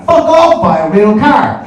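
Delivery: high, loud mock-furious shout; explosive, slow-punched dismissal; comic outrage. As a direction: bellow it at a smug driver. Shouted retort to smart car drivers.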